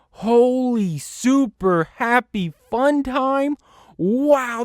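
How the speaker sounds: slow, high, awestruck exclaim; amazed. Real, not acted. Gamer awed at the huge airplane, slow amazed exclaim. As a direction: draw out the wonder.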